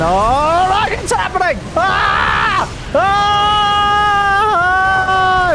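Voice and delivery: high, loud, long excited scream; screaming hype. Real, not acted. Caster screaming as the big fight breaks out. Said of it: "I'm sorry for raising my voice".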